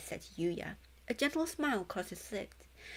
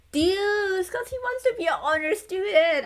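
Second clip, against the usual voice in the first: higher and louder, eager and delighted; excited guess; 11 semitones higher, 10 dB louder than usual. Real, not acted. Fan recognising a character in the artwork, gleeful high guess. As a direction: blurt the name out gleefully.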